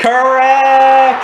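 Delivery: high, loud game-show cheer; excited announcement. Real, not acted. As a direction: announce the right answer like a quiz host. Game host confirms guess.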